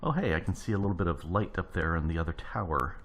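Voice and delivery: ordinary delivery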